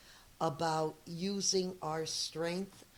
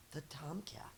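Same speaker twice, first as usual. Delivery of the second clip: hushed whisper on the name; 11 dB quieter than usual. Acted. Teacher whispering the villain cat's name to children, hushed. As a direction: breathe it like a secret. Sneaky cat introduced in story.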